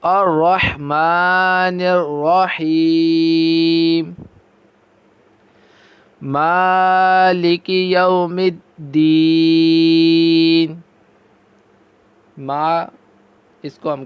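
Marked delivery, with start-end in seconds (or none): 0.0-10.8 slow, drawn-out melodic tajweed chant; Quran recitation. Shown as a demonstration. Teacher reciting Quran for students. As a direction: recite each verse long and measured.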